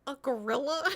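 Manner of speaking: mock disbelief. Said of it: "We're like".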